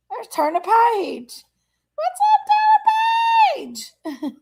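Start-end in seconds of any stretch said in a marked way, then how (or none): 1.9-4.3 high, slow, drawn-out singsong hello; sing-song greeting. Real, not acted. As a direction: stretch the greeting out like a happy call. Greeting a viewer in live chat.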